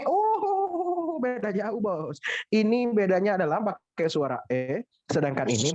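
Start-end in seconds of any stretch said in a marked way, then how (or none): none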